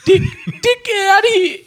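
high loud comic yell; mock shout. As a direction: shoo him off loudly.

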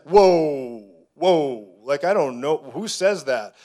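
a friend thrown by a strange remark, loud taken-aback whoa; startled friend. Retold or imitated. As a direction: back off in mock alarm. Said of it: "And he's like".

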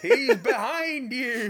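high, slow laughing shout; panto call-out. Acted. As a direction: yell it like a panto crowd.

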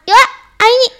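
ordinary talk, nothing marked

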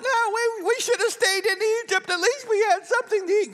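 high-pitched, fast mock whine; whining. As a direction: imitate grumbling Israelites, whining up high. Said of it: "Whining is not a godly approach".

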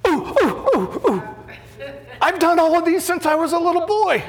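high, loud, eager hand-waving voice; Horshack impression. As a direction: squeal it out like the eager kid in class. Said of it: "he's more like Horshack".

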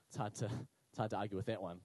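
low, deflated pupil giving in; mock-defeated, dropping low; resigned concession. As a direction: give up the argument in a low voice. Pupil gives in to teacher.

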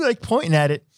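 normal talking voice

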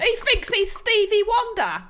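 high loud Essex aside. Pretend.

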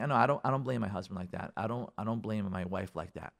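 low, soft, quick disclaimer; mild denial. Acted. Listeners denying they blame their spouses, mild. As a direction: brush the charge off quietly.

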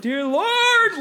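high, loud wail on Lord; retold mid-prayer cry. Friend imitating the teacher falling while praying, high mock cry. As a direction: cry out the prayer as you topple over. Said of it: "she was like".

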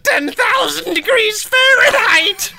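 loud, slow mock proclamation; mock conspiracy theorist. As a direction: declare it like a conspiracy nut.

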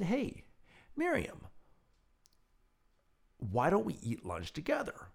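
high, coaxing friendly invitation; persuasive and friendly. Retold or imitated. Coaxing a resident to share lunch, high and friendly. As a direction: sweeten the offer to win her over. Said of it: "I was real persuasive and real friendly".

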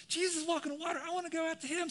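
high-pitched eager burst; giddy excitement. Acted. Peter caught in the moment, giddily excited. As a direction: blurt it like a child on Christmas morning. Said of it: "he's in that moment".

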